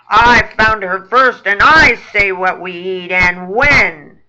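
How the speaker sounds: very loud, bossy rat growl. Acted. Boss rat claims the frog he found, growling. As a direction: lay down the law in a loud growl. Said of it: "growls Fats".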